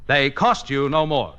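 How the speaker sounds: usual speaking voice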